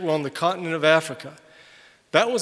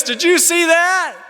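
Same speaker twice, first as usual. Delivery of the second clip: much higher and louder, a loud American gasping in the subway; loud American tourist; 11 semitones higher, 6 dB louder than usual. Retold or imitated. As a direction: blurt it out loud like a noisy American abroad. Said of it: "Because he's talking like this".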